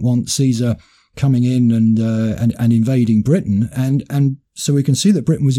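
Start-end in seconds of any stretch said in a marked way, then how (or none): none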